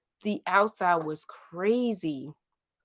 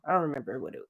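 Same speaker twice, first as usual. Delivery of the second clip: ordinary delivery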